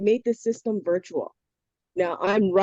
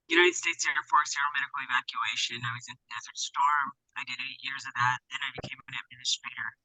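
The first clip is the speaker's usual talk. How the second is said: inside a metal tank; 6 semitones lower, 6 dB quieter, faster than usual. Real, not acted. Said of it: "Fix your volume".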